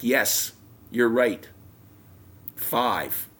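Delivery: loud, angry admission, acted out; grudging, still mad. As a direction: concede loudly while re-enacting your anger. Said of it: "But I'm still mad".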